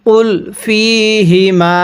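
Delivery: "ফিহিমা" slow, with the fi held one alif long; elongated recitation. Shown as a demonstration. Teacher shows a one-alif stretch on fi. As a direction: stretch "কুল ফিহিমা" slowly, holding fi. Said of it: "এক আলিফ টান দিব".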